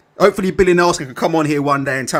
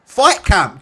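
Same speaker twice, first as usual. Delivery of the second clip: normal talking voice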